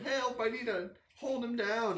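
high pleading call for help; mock urgent plea. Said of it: "It's like".

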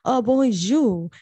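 put-on playful French accent, slow and drawn out on bonjour; mock French accent. Joking with her cohost in a mock French accent.

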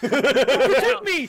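slow, loud, laughing cry for help; mock panicked plea. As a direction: ham up the panic.

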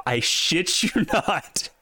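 slow, rising voice breaking into a laugh; laughing disbelief. Real, not acted. Host reading a quarterback's terrible rating, laughing disbelief. Incredulous reading of awful stats.

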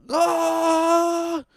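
sudden high scream; a piercing yell to clear the road; biker's wordless warning scream. Retold or imitated. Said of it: "And he just goes".

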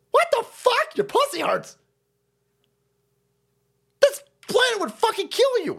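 high, incredulous yelling; scornful outburst. Real, not acted. Host berating imagined whiners, scornful and exasperated. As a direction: berate the crybaby in disbelief.

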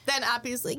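ordinary talk, nothing marked

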